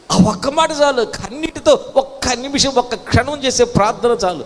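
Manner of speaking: fervent preaching. Real, not acted. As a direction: preach it with rising fervour. Preaches emphatically.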